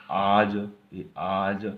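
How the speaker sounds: आज loud and slow, with a long aa; teacher slowly sounding out आज; pronunciation demo. Shown as a demonstration. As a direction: stretch the vowel in आज each time. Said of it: "अज है".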